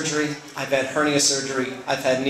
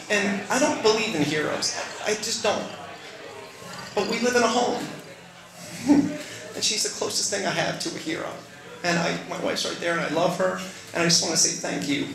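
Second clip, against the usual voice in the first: higher, softer, voice close to breaking; moved to tears; 4 semitones higher, 4 dB quieter than usual. Real, not acted. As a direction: hold back tears while thanking her. Tearful tribute to wife present.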